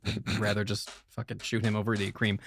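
ordinary talk, nothing marked

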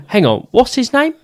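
high, bright impression of the guy; squeaky put-on voice of the star; high mock celebrity voice. Imitating the celebrity he met.

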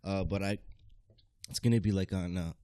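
breathless and winded; gasping between words; out of breath. Real, not acted. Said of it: "out of breath over here".

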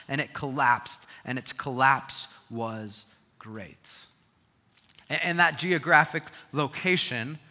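loud, driving sermon delivery; forceful preaching. Acted. Pastor preaching to the church, loud and driving. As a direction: preach it hard to the congregation.

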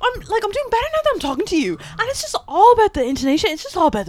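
very high, gushing singsong; up-and-down radio intonation. Retold or imitated. Guest mimicking herself being interviewed on radio, exaggerated swooping intonation. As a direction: send up radio interview chat with swooping pitch. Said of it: "voice and just going up and down".

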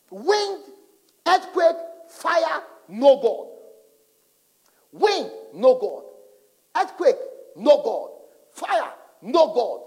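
loud, slow, high pulpit cadence; building preacher's chant; preaching at full force. Acted. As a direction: hammer each word like a sermon climax.

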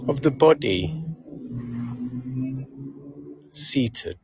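unhurried meditation guide, long pauses between phrases; calm, measured relaxation cues with lingering silences; guided meditation. Acted. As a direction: ease them through a body scan, leaving space after each phrase.

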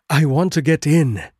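out of breath, high and loud; panting from shaking the door; breathless panting. Acted. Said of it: "I panted, breathless with my exertions".

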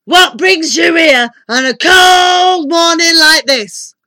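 slow, high shouted question; thundering. A narrator's reading. Giant wizard asks his visitor's business, thundering. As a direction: bellow it with long drawn-out words. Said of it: "thundered Wizard Winterbottom".

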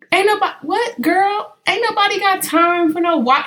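put-on incredulous what girl; outraged disbelief. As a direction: refuse the explanation with sass. Outraged reply to mom.

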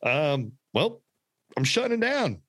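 high, gleeful mock boss voice; mock Elon announcement. To Twitter staff, high gleeful mock announcement. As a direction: play a boss cheerfully firing everyone.

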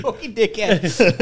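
loud, high, slow comic swearing; mock cursing. Joking about the dad who passed away, mock curse. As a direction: draw out the curse as a joke. Mock abuse of late father.

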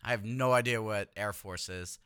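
ordinary delivery

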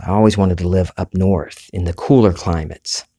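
normal talking voice

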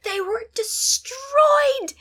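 very high, drawn-out triumphant cry; gleeful triumph. Retold or imitated. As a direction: stretch it out in glee. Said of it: "And she's like".

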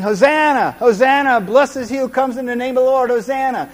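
loud, high cheering cries of Hosanna; crowd shouting. Retold or imitated. Preacher voicing the parade crowd for listeners, loud joyful shouts. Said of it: "Everybody's shouting".

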